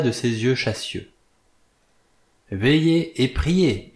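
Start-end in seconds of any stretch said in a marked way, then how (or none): 2.5-3.9 put-on exhorting old prophet. A narrator's reading. Old sailor exhorts Blore from the step, slow and solemn. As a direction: preach it slowly like a doom prophet. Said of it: "exhorta-t-il".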